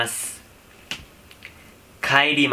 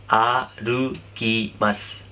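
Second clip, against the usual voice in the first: slow drill; much slower than usual. Shown as a demonstration. Teacher teaching the verb to walk, slow and careful. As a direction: draw out आरुकीमास syllable by syllable. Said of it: "हम walking को बोलते हैं".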